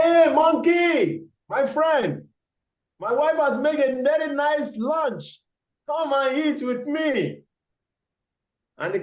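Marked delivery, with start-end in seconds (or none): 0.0-7.4 sly crocodile. Acted. Crocodile invites the monkey.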